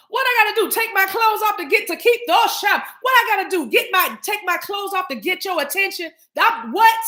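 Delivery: high, loud incredulous rant; exasperated. Real, not acted. Ranting at the chat, high and incredulous. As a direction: demand an answer, fed up. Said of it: "repeating exasperated rant".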